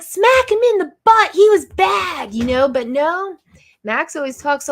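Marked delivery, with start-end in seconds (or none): 0.0-2.3 much higher, louder, hammed-up angry yell; mock angry shout. Mom mock-yelling at husband to punish the dog, hammed-up shout. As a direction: play the furious mom demanding a smack. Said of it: "I'm like".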